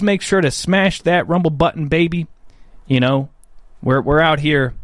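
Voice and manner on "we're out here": ordinary talk, nothing marked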